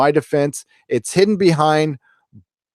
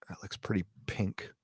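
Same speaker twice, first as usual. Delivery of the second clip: very soft, voiceless whisper; hushed, lower aside under the breath; 6 semitones lower, 19 dB quieter than usual. Real, not acted. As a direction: whisper it to yourself. Muttered aside while checking a photo.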